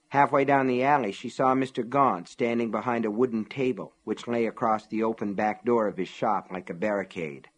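plain reading voice

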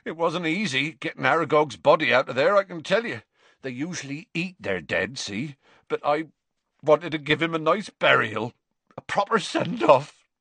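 a hint of a grieving giant; Hagrid voice. A narrator's reading. To Harry about the forest, lightly voiced Hagrid.

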